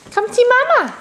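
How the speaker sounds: high coaxing call. Real, not acted. Calling toddler to come.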